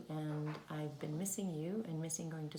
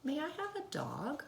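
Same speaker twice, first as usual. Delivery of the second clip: put-on child asking; 11 semitones higher than usual. Child asking for dog, high.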